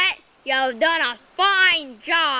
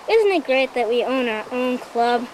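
ordinary delivery

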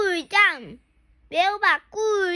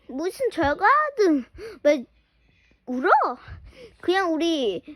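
normal talking voice

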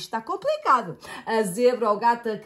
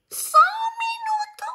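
very high squeaky voice; tiny squeaky hamster; squeaking hamster. A narrator's reading. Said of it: "chia o hamster".

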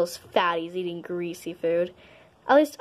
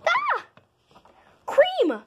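very high piercing shriek; scream. Acted. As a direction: shriek in pain. Scream in the fight.

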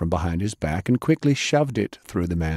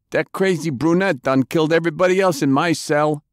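pleading thug, pitched much higher; nervous captured thug, higher and rushed; frightened prisoner; 9 semitones higher than usual. Acted. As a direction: babble it out to save his skin.

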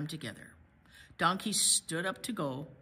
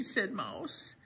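ordinary delivery, nothing marked

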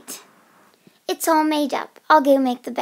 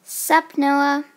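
ordinary delivery

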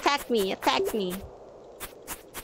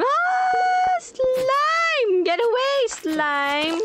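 panicked scream; 13 semitones higher, much slower than usual. Real, not acted. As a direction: shriek to drive the slime away. Slime suddenly attacks her.

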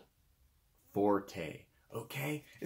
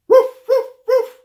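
loud, high, short woofs; dog bark; 17 dB louder than usual. Shown as a demonstration. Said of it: "bark like a dog".

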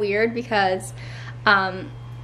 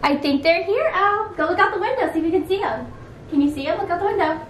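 higher-pitched, eager talk to a little one; 7 semitones higher than usual. Real, not acted. Excitedly prompting child to look out.

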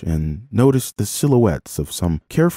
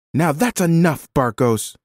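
higher-pitched, firm call to stop; woman shouting; 9 semitones higher than usual. A narrator's reading. Woman stopping a man handling a child, shouting. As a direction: step in and order him to stop. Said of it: "shouted the woman".

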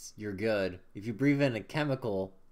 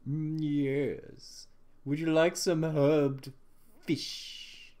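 merchant voice; 3 semitones higher, much slower than usual. Pretend.

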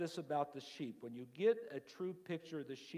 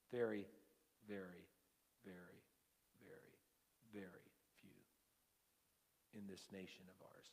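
each "very" softer until it is a whisper; fading to whisper; 5 semitones lower, 10 dB quieter than usual. Acted. Repeating very, fading away.

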